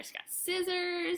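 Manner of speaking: plain reading voice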